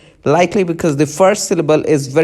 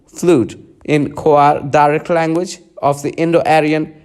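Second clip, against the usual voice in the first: normal talking voice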